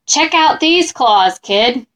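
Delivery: ordinary delivery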